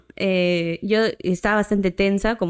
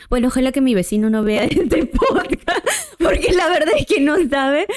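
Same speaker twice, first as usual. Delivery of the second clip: laughing; 4 semitones higher than usual. Real, not acted. Laughs about neighbor seeing podcast.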